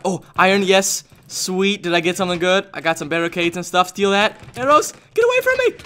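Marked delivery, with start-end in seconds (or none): 4.6-5.8 high panicked yelp at an attacker; playful, high-pitched alarm; mock alarm. Attacked by arrows in game.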